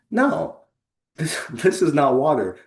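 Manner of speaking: high, insistent storybook protest; exasperated young fish. A narrator's reading. Young fish contradicting old fish, high exasperated protest.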